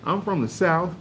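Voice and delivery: hint of a Southern comedienne; mock country woman. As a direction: set up the joke up high. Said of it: "She said".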